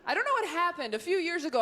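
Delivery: usual speaking voice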